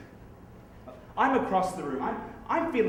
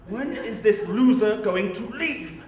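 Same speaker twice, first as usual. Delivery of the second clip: slow, higher, louder impatient complaint; exasperated inner thought; 4 semitones higher, 4 dB louder, slower than usual. Acted. Comedian voicing his silent thought at a rival in his seat, exasperated. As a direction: stretch it out in mounting impatience.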